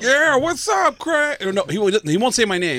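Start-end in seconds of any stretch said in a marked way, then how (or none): 0.0-1.4 high mock Tracy greeting; Tracy impression. Craig imitating Tracy greeting him, high pitched. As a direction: toss off a high casual hello as Tracy. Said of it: "He'll be like".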